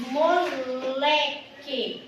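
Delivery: moleque slowly split, with a long strong LE; stress on LÉ. Shown as a demonstration. Teacher demonstrates the tonic syllable in moleque, stressing LE. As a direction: stretch moleque, leaning on the middle le. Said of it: "O lé é a sílaba mais forte".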